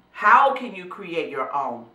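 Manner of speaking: usual speaking voice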